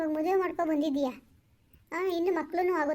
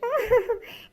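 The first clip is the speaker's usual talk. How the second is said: higher mocking giggle; gloating laugh; 6 semitones higher, 3 dB louder than usual. Lover laughing mockingly about the village wife. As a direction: laugh at the rival's expense.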